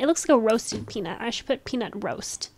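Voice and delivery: usual speaking voice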